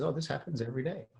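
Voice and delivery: light imitated Indian accent, quick and soft; a friendly hint of an Indian driver's accent, brisk. Indian driver reassuring a first-time visitor, light Indian accent. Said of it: "in his nice Indian voice says".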